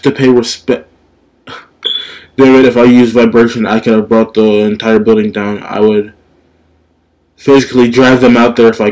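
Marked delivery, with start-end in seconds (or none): none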